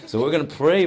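laughing a little while relaying a remark; amused. Real, not acted.

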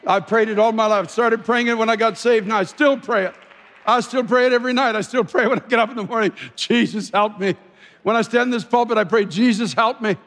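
high, loud, driving testimony; fervent preaching. Real, not acted. Preacher testifying about his lifelong prayer, fervent preaching. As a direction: preach it with rising urgency.

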